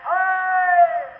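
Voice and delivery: high, slow drawn-out soldier's shout; battlefield shout. Acted. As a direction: call it across the battlefield, slow. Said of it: "you could hear the front file shout".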